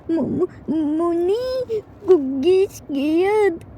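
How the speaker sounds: put-on slow, stammering little child voice; scared toddler. Toddler tells grandma he is scared, stammering. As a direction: stammer it out like a frightened small boy.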